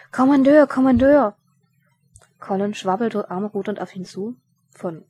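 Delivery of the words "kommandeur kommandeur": loud, high call across. Acted. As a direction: shout to get his attention. Sergeant calling out from afar.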